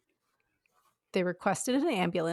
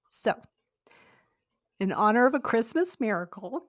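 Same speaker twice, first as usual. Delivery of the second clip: ordinary talk, nothing marked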